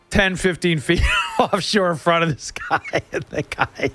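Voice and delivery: talking through laughter; words breaking into a laugh. Real, not acted. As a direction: crack up while telling it. Cracks up telling his own story.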